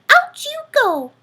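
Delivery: tiny mouse being firm; squeaky mouse voice, stern little push. A narrator's reading. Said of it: "Mortimer said".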